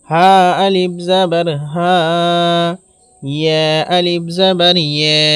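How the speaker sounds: spelling drill with লা, মা, না held long; one-alif elongation. Shown as a demonstration. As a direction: stretch each syllable after জাবার evenly. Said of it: "এক আলিফ পরিমাণ টান দিয়ে পড়িতে হবে".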